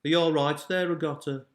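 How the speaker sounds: amateur Birmingham accent impression; Birmingham accent attempt. Shown as a demonstration. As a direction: have a playful stab at Brummie. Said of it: "Can you do a Birmingham accent".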